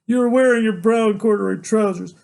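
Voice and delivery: high, loud, urgent recounting; agitated outpouring. A narrator's reading. Son proving his memory to father, agitated high outpouring. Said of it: "talking so fast it makes me shake".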